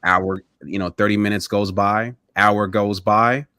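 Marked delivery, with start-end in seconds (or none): none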